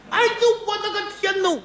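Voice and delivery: high-pitched shriek of fright; a wailing shriek at the sight of a severed head; ghostly terrified cry. Acted. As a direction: scream in terror, voice cracking high. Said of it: "我喊出鬼音儿来了".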